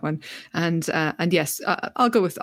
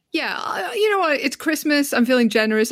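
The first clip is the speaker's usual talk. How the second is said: excited; 6 semitones higher than usual. Real, not acted. Sudden generous change of heart.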